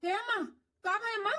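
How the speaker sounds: high, alarmed calls to a fainted mother; panicked calling. Acted. Son calling his unconscious mother, alarmed and high. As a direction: call out to her in panic.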